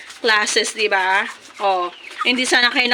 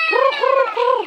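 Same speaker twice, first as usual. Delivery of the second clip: very high rolled krr krr call; high rolled trill; 12 semitones higher than usual. Real, not acted. Vlogger trilling to call her chickens to eat.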